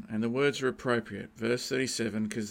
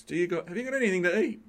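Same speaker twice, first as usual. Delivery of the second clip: a quick, higher, lightly voiced hungry teenager; teenage boy asking; 6 semitones higher, 4 dB louder, much faster than usual. Preacher imitating a teen asking for food, quick and casual. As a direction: rattle it off like a kid raiding the fridge. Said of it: "parent of a teenage boy".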